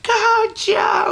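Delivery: piercing, very high imitated cheer; most shrill voice. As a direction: screech it like a shrill woman at ringside. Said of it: "like, the most shrill voice".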